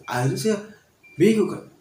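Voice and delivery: ordinary talk, nothing marked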